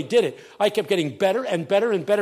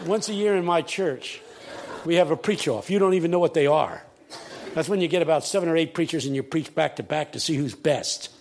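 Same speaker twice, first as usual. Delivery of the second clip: normal talking voice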